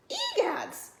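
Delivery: very high startled exclamation; Mama Bear exclaims. A narrator's reading. Mama Bear shocked by the bed, high startled exclaim. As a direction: gasp it out high. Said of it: "said Mama Bear".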